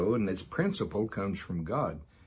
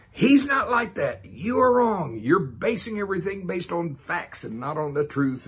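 indignant tirade; 9 semitones higher, 6 dB louder than usual. Retold or imitated. Teacher re-enacting his tirade at the TV, indignant. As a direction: re-enact shouting back in protest, pitched way up. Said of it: "I'm talking to the T V. And I'm going".

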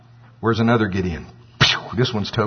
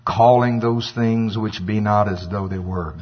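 ordinary talk, nothing marked

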